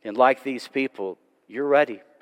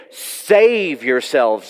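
each word drawn out slowly and pressed; plead, slow and urgent; slow earnest plea to the crowd; much slower than usual. Retold or imitated. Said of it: "He's begging them".

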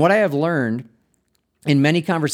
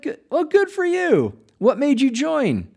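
bright, much higher, overly chipper voice; mock cheery greeting; 12 semitones higher than usual. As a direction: play the eager, too-friendly greeter at the gym. Pastor greets newcomer at gym.

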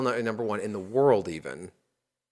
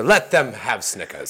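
Marie Antoinette; 5 dB louder, slower than usual. Pretend. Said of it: "Mary Antoinette's over there".

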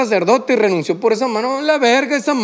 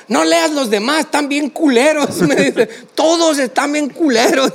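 imitando a la mamá; 6 semitones higher than usual. Retold or imitated. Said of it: "me dijo".

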